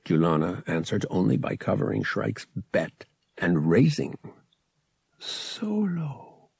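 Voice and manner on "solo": hushed, wondering whisper; soft whisper. A narrator's reading. Boy trying out his new surname, whispered softly. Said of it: "whispered softly".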